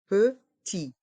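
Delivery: "Petit" in two separate beats, silent final T; learner-paced, split "Petit"; syllable by syllable. Shown as a demonstration. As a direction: break "Petit" into pe and ti. Teacher breaks word into syllables.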